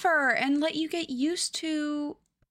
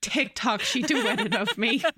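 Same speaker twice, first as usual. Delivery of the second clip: ordinary delivery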